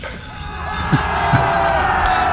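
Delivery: loud burst of laughter at a punchline; hearty laugh. Real, not acted.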